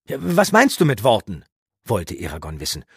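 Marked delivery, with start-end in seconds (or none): 0.1-1.4 loud, higher eager youth; eager question. Acted. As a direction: press the question. Eragon fragt drängend. (drängende Frage)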